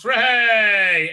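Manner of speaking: hammed-up celebration; mock cheer. Reviewer mocks the rock-breaking contest, mock cheer.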